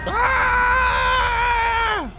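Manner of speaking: loud high scream; startled scream. Real, not acted. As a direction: scream in shock. Shout as shuttle crashes.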